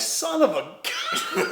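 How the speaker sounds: slow, laughing through the words; laughing disbelief. Retold or imitated. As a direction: break into a laugh mid-curse.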